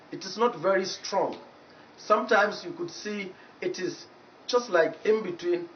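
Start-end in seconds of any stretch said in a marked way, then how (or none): none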